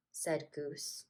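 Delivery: usual speaking voice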